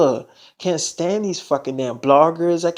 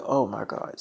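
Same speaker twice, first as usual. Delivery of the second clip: much lower, softer stunned oh my God; hushed shock; 7 semitones lower, 8 dB quieter than usual. Retold or imitated. Mother hearing her daughter is pregnant, hushed shock. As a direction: breathe it out in shock.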